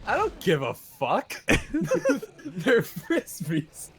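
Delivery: mock rude kid, slow and breaking into laughter; laughing imitation. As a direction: lay it out slowly, cracking up.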